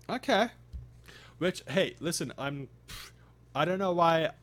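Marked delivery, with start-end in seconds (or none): 0.1-0.5 high, loud, exaggerated "okay"; odd okay. Real, not acted. Streamer reacting to a chatter who watches on YouTube, hammed-up okay. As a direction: toss out a high, loud, mock-surprised okay. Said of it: "I don't know why I said it like that".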